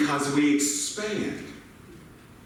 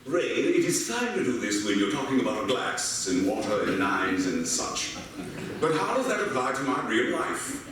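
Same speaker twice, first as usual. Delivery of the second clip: lightly voiced doubter, lower at first; skeptical listener; 6 semitones lower than usual. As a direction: put a mild objection in a listener's mouth, starting low. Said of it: "some of you may be thinking".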